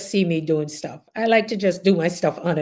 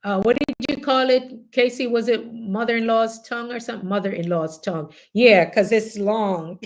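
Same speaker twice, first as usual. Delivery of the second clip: ordinary talk, nothing marked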